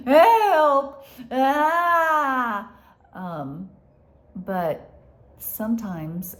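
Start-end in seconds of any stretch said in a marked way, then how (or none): 0.1-2.7 loud high mock shout for help; a hammed-up frantic cry for help; panicked cry. Panicked asking, not in relationship.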